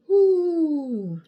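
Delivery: loud amused laugh into an ooh. Real, not acted. Speaker laughing at her own joke, loud laugh. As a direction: let a big laugh roll out.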